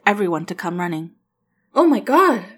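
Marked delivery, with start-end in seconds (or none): 1.7-2.5 high, loud cry of shock; woman crying out as he collapses; panicked cry. A narrator's reading. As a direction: cry out in horror. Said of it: "Amy cried".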